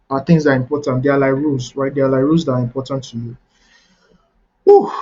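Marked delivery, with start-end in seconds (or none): 4.6-4.9 real puff of breath after much talking; winded whew. Real, not acted. Speaker needing a break from a long talk, winded. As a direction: blow out a tired "whew". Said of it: "I've been talking a lot".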